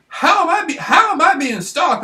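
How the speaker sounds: voice breaking. Real, not acted. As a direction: pitch jumps as the voice cracks. Voice cracks, upset about stalking.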